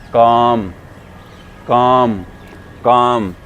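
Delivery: काम loud, with a held "aa"; long aa sound. Shown as a demonstration. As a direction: stretch काम into "kaaam". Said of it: "इसको बोलते हैं".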